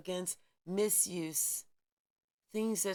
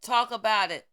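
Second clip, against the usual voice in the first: a sudden loud burst; loud command to speak out; loud urging; 12 dB louder than usual. Real, not acted. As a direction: snap back loudly after the hush.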